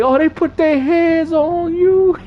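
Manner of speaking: high, slow, drawn-out movie quote; Chris Tucker impression. Streamer quoting his favorite movie scene, high and slow. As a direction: stretch it out like the comic character. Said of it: "the Chris Tucker part".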